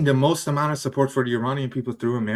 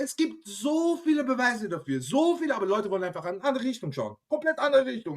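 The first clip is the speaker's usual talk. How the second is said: climbing higher and louder into a furious rant; building rant; 10 semitones higher, 5 dB louder than usual. Real, not acted. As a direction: work yourself up, pitch soaring with each line. Heated German rant about proof.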